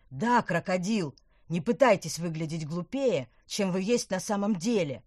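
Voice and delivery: loud venomous snarl; spitting venom. Acted. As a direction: spit every word with contempt. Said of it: "брызгал ядом Плюгавец".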